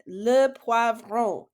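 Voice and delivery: "Le poivron" slow and clear; teacher modelling for students to repeat; slow model word. Shown as a demonstration. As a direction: say it slowly for them to copy. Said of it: "I'll say it, you say it".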